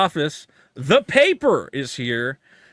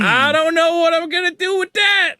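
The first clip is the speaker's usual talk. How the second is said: much higher put-on caricature voice; mock grumbling actress; 11 semitones higher, 3 dB louder than usual. Host voicing the woman on the poster, high put-on complaint. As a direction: mutter it like a disgruntled caricature. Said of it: "She's looking at Whitey going".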